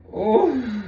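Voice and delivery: ordinary talk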